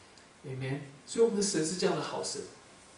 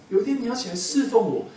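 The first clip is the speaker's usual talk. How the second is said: higher and louder, 天 stressed on the repeat; emphatic repeat; 7 semitones higher, 9 dB louder than usual. Acted. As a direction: drive the promise home, louder this time. Repeated line in God's voice.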